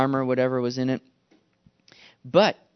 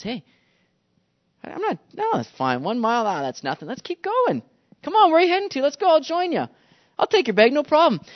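high-pitched, easygoing insistence; eager volunteer; 7 semitones higher than usual. Acted. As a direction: wave it off cheerfully, voice lifted. Willing walker insists to soldier.

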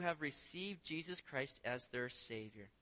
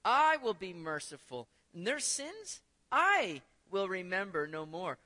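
each "I" punched loud; stress on I; 7 dB louder than usual. Shown as a demonstration. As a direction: preach it loud, leaning on every "I". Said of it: "Where's the emphasis?".

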